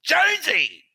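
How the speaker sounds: high yelled call across a casino; yelled name. Retold or imitated. Fan yelling across the casino, high yelled call. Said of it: "this bloke yells out".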